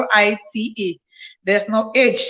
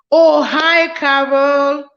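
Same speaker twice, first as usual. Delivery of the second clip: excited greeting; 4 semitones higher, 5 dB louder, much slower than usual. Real, not acted. Greets a new viewer joining.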